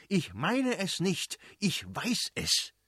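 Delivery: slow, high, deliberate correction; indignant, measured retort; emphatic insistence. A narrator's reading. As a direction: stretch each phrase with irritated certainty. Prince corrects wife in acted quarrel.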